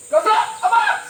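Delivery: high, loud, forceful war cry; strong fighting shout. Shown as a demonstration. Leader showing campers a strong response, shouted. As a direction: shout it like you are ready to fight. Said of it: "So dapat strong din yung ating sigaw".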